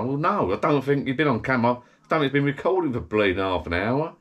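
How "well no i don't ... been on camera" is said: ordinary talk, nothing marked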